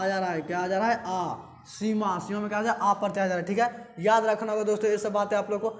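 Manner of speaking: ordinary talk, nothing marked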